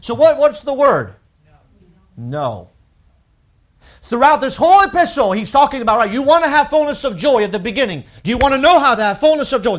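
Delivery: loud, high preaching surge; preaching at full force. Acted. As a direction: lift the congregation with it.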